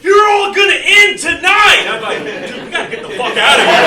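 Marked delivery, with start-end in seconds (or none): 0.0-1.9 screaming high through tears; tearful shrieking threat to the room; crying threat. Acted. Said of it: "tears streaming down their face". 2.5-3.8 1 panicked. Retold or imitated. Panicked flee reaction.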